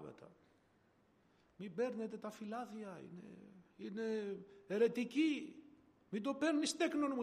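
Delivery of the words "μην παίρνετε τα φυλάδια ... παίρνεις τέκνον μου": higher, imitating a preacher warning off leaflets; half-mock fearful warning, pitched up; retold shouting. Preacher warns passers-by not to take leaflets, mock shouting warning. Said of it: "φώναζε".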